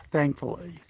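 ordinary talk

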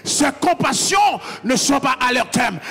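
loud, rising sermon cadence; full-force sermon voice; fiery preaching. Acted. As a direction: preach it out to the congregation.

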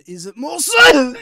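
slow words breaking into cough. Pretend. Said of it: "break out into a coughing fit".

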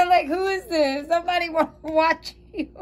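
high, giggling through the words. Real, not acted. Tarot reader giggling over the naughty card, high and laughing. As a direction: laugh while asking who it is.

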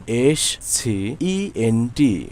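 slow letter-by-letter spelling. Shown as a demonstration. Spelling the word out for learners. (ধীরে অক্ষর ধরে বানান)

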